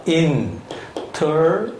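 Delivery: "international." drawn out syllable by syllable, very slowly; very slow model word. Shown as a demonstration. Teacher slowly models "international." syllable by syllable for the class. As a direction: stretch every syllable of "international." out for dictation. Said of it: "음절 단위로".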